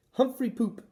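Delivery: high, loud cheeky shout; giggly child's yell. A narrator's reading. Little boy cleaning the cage, cheeky loud yell. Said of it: "he yelled".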